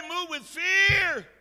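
high, very loud shout with stretched words; shouted exhortation. Real, not acted. Preacher telling listeners God's word for today, shouted exhortation. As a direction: shout the command as the climax. Sermon climax, then soft repeat.

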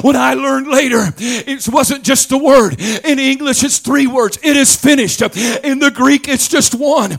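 whooping preacher's crescendo; preaching at full force. Acted. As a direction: shout the victory to the congregation.

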